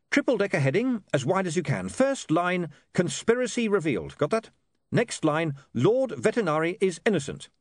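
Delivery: high, urgent character voice; editor dictating headlines. Acted. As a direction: dictate each headline line crisply.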